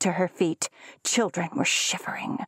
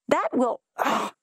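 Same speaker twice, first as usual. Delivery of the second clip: choked cry; 6 semitones higher than usual. A narrator's reading. Jules chokes mid-line.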